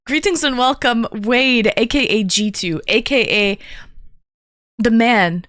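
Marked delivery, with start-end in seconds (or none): none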